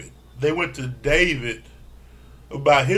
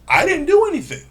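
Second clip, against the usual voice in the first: mock defensive Simone; 13 semitones higher, 3 dB louder than usual. Simone defending herself, acted quote.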